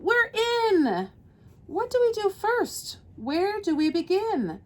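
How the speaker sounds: high-pitched, eager storybook cry; excited yell. A narrator's reading. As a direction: pipe up like a thrilled little crew. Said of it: "yelled out".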